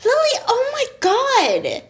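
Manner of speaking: high, drawn-out shocked reproach; imitated scandalized classmates. Acts out scandalized classmates' reaction.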